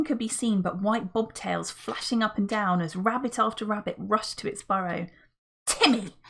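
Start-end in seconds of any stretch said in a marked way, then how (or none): 5.7-6.2 cross call. A narrator's reading. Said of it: "called George crossly".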